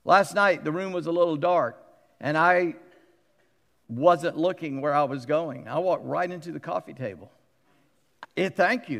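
ordinary talk

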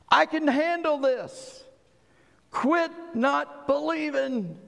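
high, drawn-out insistent cry; emphatic divine rebuke. Acted. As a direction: thunder it as God overruling a doubter. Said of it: "it's to be emphatic like that".

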